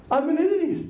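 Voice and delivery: Bernie's line, pitched high and cracking with laughter; laughing quote. Retold or imitated. Bernie admitting it to the whole party, said high through laughter. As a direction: laugh through the punchline, voice jumping up.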